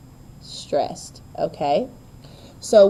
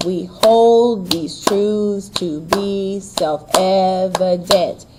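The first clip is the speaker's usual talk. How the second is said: slow syllable-by-syllable beat, HOLD, TRUTHS, BE stressed; drawn-out heartbeat rhythm on "We hold these truths to be self-evident"; exaggerated iambic stress; much slower than usual. Shown as a demonstration. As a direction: chant it slowly, light-strong, leaning on HOLD, TRUTHS, BE, EV, DENT. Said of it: "unstressed, stressed".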